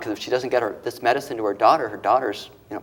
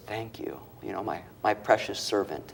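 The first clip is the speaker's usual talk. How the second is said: very soft, hushed thanks; hushed voice of God; 2 semitones lower, 9 dB quieter than usual. Retold or imitated. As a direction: breathe it almost silently.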